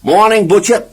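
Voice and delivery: chirpy good-morning to the butcher; cheerful. Acted. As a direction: call out brightly. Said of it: "he said cheerfully".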